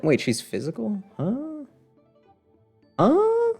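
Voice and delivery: ordinary delivery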